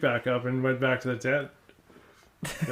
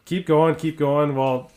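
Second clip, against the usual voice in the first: ordinary talk, nothing marked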